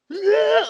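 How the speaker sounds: high excited fan yell; imitating a fan shrieking "Ah" in the crowd. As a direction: squeal like a hyped crowd member. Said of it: "dudes are like".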